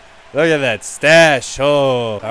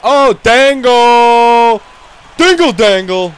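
slow stretched cheer, louder and higher; excited cheer; 6 semitones higher, 8 dB louder, slower than usual. Acted. Player cheering his own deke, slow excited cheer. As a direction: savour the dangle out loud. Celebrating a move.

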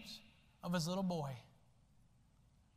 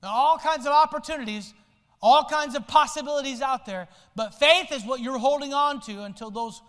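louder, higher preaching voice; full-force pulpit preaching; fiery preaching; 3 semitones higher, 5 dB louder than usual. Acted. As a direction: preach it out to the congregation.

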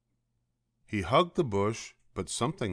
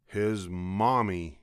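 ordinary delivery

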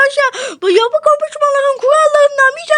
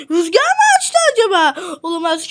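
ordinary talk

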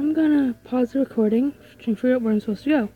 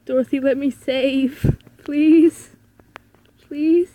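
pleading; 3 semitones higher, 6 dB louder, much slower than usual. Pretend. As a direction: mock-desperate plea, rising on please. Mock pleading with game character to save.

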